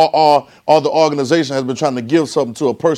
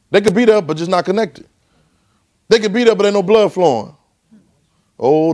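fervent preaching; 5 semitones higher than usual. Acted. Preaching at full force.

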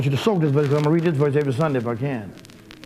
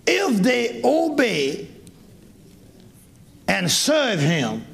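slow, deliberate reading of the verse; 4 semitones higher, much slower than usual. Shown as a demonstration. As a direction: lay out each word slowly. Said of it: "Listen to, listen to this voice".

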